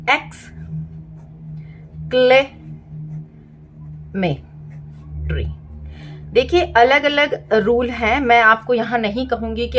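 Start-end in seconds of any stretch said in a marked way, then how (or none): 0.1-5.5 exclamatory stretched out very slowly, syllable by syllable. Shown as a demonstration. Teacher to parents, breaking a word for kids, very slow. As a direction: sound out exclamatory slowly in parts, like a phonics demo. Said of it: "तो".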